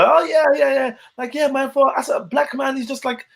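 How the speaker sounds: a hint of the guy eagerly insisting; imitating the guy. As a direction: brush off the correction, gushing on. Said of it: "he was just on the".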